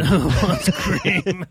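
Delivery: slow, laughing through the line; laughing catchphrase. Retold or imitated. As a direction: laugh while drawing it out. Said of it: "Is that what you say?".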